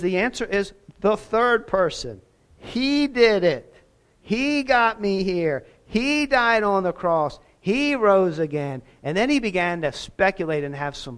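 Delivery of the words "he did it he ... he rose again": emphatic proclamation. Retold or imitated. Quoting Begg, raised emphatic.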